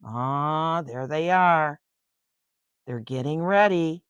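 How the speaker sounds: ordinary delivery, nothing marked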